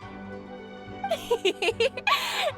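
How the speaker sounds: light high giggle. Acted. Giggle amid friendly game dialogue.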